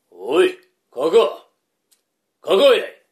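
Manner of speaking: loud call across the house. Acted. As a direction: call out to the wife. Calling out to his wife.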